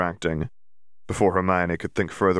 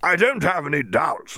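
Bagman, hearty; 9 semitones higher than usual. Acted. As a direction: lay on the enthusiasm like a jolly sports official. Bagman voiced.

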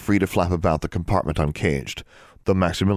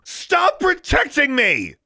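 high, drawn-out furious shout; 4 dB louder, much slower than usual. A narrator's reading. Sister to protective brother, shouting. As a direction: shout it slowly at top pitch. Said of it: "she shouted".

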